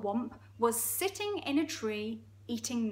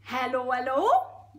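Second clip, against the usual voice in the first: Geordie hippo; 3 semitones higher, 9 dB louder, slower than usual. Acted. Hippo greeting the crocodile, Geordie accent. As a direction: sing out a hello in broad Geordie. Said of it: "It's really Geordie".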